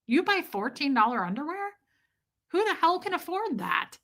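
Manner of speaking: high, swooping disbelief; incredulous. Real, not acted. Streamer reacting to viewer's fourteen dollar underwear, incredulous. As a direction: question it with swooping, scandalized pitch.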